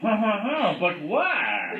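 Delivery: hammed-up French wail; French accent. A narrator's reading. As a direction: cry out in a mock French accent. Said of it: "cried in his fabulous French accent".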